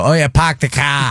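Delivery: "park the car" as "pahk the cah", a light mock Boston accent. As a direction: mimic how everyone does Boston. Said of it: "they go".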